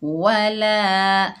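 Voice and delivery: slow, drawn-out Quranic recitation. Shown as a demonstration. Teacher reciting the word for pupils, slow chanted recitation. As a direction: recite it slowly, like tilawat. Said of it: "एक अलिफ की मिकदार खींच कर पढ़ेंगे ला".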